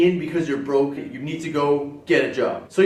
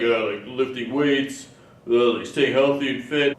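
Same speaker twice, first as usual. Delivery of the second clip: dopey gym guy, pitched down; meathead; 5 semitones lower than usual. Acted. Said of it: "one meathead's gonna be like".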